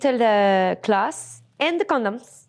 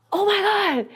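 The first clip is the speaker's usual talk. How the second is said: mock surprised client; 9 semitones higher, faster than usual. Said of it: "He's like".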